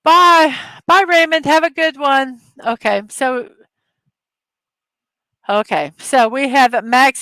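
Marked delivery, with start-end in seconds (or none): 0.0-2.4 calling out. Real, not acted. Calling goodbye to a viewer.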